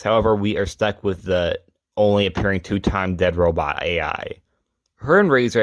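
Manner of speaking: normal talking voice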